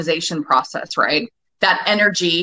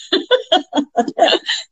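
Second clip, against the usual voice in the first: high burst of laughter; real laugh; 10 semitones higher than usual. Real, not acted. Speaker laughing at her own blunt confession, high pitched.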